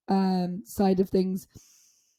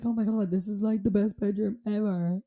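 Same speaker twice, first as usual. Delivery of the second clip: hushed mock awe; 7 dB quieter than usual. Talking to self about the bedroom, hushed awe. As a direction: marvel softly, as if to yourself.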